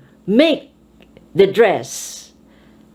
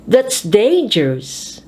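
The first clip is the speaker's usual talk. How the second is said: first syllable of "dangerous" raised and stretched; stress on DANGEROUS. Shown as a demonstration. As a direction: put force into the start of "dangerous". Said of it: "put force into adjectives".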